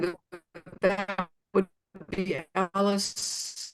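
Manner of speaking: choppy, breaking-up call audio; glitchy robotic call. Real, not acted. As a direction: voice dropping out on a bad line. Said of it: "your audio is breaking up pretty badly".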